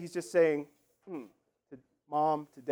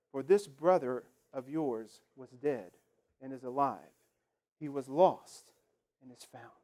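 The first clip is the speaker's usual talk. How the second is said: scripture reading; 4 semitones lower than usual. Acted. Pastor reading the parable to the congregation, measured.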